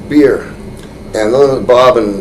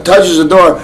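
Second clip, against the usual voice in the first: ordinary talk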